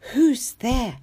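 ordinary delivery, nothing marked

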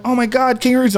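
high-pitched retold delight; awed excitement. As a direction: mimic a thrilled tourist. Retold tourist delight at kangaroos.

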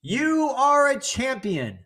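very high, slow, sustained holler; echoed call. Acted. Mountain voice answering the man, long high call. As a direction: send it back as a drawn-out echo. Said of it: "And the voice answers".

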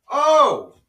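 a high, loud "oh" as it finally clicks; the penny drops out loud; sudden realization. Real, not acted. Finally remembers the song title.

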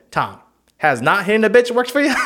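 loud, high mock-reasoning pimp; hammed-up pimp lecturing; A Pimp Named Slickback. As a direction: press the question like a cartoon pimp. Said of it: "he was trying to teach Tom".

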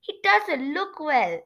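high, slow nasal character voice; Shivereen. Acted. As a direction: draw it out high through the nose. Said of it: "trying to create her voice as nasal".

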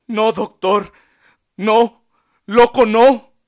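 high, loud, desperate begging; sobbing plea. A narrator's reading. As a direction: cry out the no's, pleading hard. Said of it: "sollozó".

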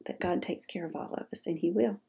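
soft hushed whispered prayer. Real, not acted. Host whispering a prayer for the sick, hushed.

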